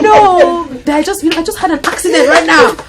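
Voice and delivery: high, loud, laughing take on him; laughing imitation. Retelling the ex-husband's night call, laughing loudly. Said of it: "He said".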